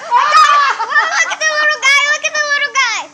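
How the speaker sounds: high, excited squeal with laughter; thrilled excitement. Real, not acted. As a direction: squeal at spotting a tiny creature. Thrilled as the bird appears.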